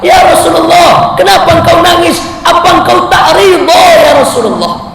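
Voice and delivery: loud, high pleading cry; anguished companion. Retold or imitated. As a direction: call out to the Prophet in alarm. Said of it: "Apa kata sahabat?".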